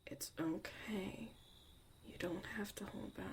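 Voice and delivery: whispered, gentle reassurance; soft reassuring whisper. Acted. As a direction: hush it out to comfort them. Reassuring someone holding back emotion.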